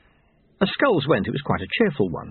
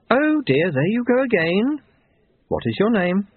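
much higher, weary reproof; exasperated stern woman; 10 semitones higher than usual. Acted. Woman questioning the intruder she has caught, exasperated. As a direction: pitch it well up, impatient with him.

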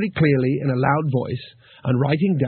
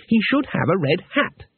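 Two Flower; 6 semitones higher than usual. Acted.

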